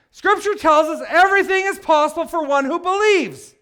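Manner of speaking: loud, high preaching at full force; impassioned proclamation. Real, not acted. Preacher declares scripture to the congregation, full-force proclamation. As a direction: proclaim it to the whole room, ringing out.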